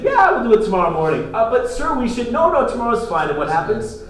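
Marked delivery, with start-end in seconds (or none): 0.0-1.3 a louder, quicker boast of putting it off; confident king. Retold or imitated. King assuring his men, in a breezy confident voice. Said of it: "who said". 1.3-3.3 much higher, nervous little voice; timid officer. Retold or imitated. Officer doubting the king's delay, in a high nervous voice.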